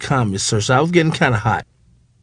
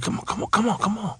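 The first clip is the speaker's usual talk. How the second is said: hushed urging; 9 semitones higher, 11 dB quieter, faster than usual. Retold or imitated. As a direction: hurry her along under your breath. Urging Rhonda quietly through door.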